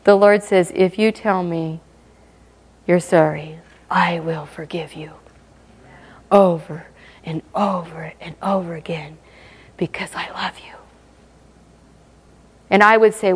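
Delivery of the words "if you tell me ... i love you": tender whisper. Acted. God telling the sinner he forgives, whispered tenderly. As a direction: whisper it like a loving father forgiving again. God's tender words voiced.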